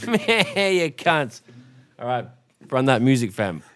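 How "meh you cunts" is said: high bleating goat voice. Pretend. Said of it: "I can throw my voice pretty good".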